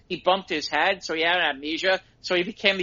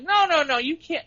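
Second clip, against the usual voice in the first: mock objection; 11 semitones higher than usual. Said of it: "it's like".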